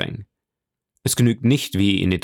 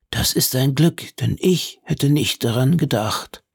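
higher-pitched character voice; put-on voice of a relieved visitor; relieved Lucchese; 4 semitones higher than usual. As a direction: keep the voice raised in grateful relief.

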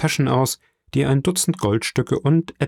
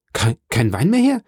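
halting question rising high at the end; stunned disbelief; 5 semitones higher, 4 dB louder than usual. A narrator's reading. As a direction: stumble into an incredulous question. Son shocked at father's empty cupboards. (ungläubig stockend)

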